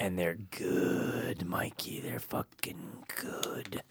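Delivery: very slow, low near-whisper; whispered, drawn-out reverence for a snack; hushed relish. Pretend. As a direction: savor every word under your breath. Savoring hot Cheetos in hushed awe.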